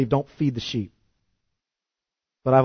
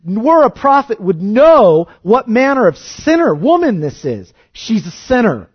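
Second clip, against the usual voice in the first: higher, louder scornful Pharisee voice; sneering leaders; 6 semitones higher, 7 dB louder than usual. Retold or imitated. Preacher mocking leaders who judge the weeping woman, scornful and loud. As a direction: mimic the sneering religious leaders. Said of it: "The leaders stand there and they say".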